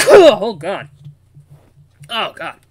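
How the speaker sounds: recovering from a sneeze; after sneezing. Real, not acted. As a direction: groan right after a sneeze. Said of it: "that was a sneeze".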